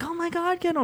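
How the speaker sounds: put-on high squealing girl voice; gushing teen girl. Older girls gushing, retold.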